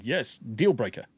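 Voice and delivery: normal talking voice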